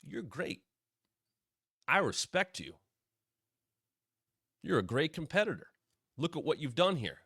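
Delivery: lightly voiced Gunther buttering up a rival; mock flattering Gunther. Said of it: "luring whoever he's talking to".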